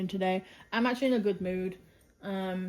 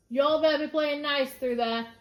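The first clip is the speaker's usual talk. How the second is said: loud, higher call to the next room; raised voice aimed at another room; 6 semitones higher, 6 dB louder than usual. Real, not acted. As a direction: call through the house to the pets. Calling out to pets elsewhere.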